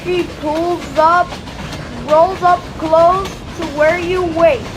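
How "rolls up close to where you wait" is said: ordinary delivery, nothing marked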